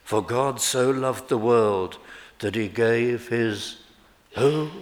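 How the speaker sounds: ordinary delivery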